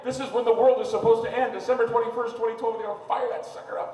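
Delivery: high, loud, indignant outburst; outraged rant. Retold or imitated. On his radio show, outraged that they will fire up the collider. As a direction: rail against them at the top of your voice. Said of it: "I did a whole show saying".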